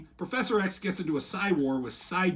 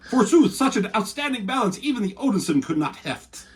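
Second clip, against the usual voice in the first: higher, louder archaic mock-heroic voice; mock Thor; 5 semitones higher, 3 dB louder than usual. Thor mocking Spider-Man's debt, hammed-up Asgardian. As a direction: boom it out like a Norse god. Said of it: "And Thor said".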